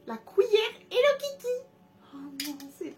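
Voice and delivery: very high, loud squeal of delight; high-pitched fan squeal. Real, not acted. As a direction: shriek with joy at the spoon. Unboxing Hello Kitty spoon.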